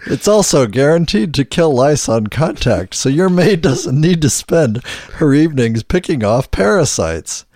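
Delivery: upbeat commercial voiceover; ad read. Acted. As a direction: sell it like a radio ad. Reads a mock sponsor ad.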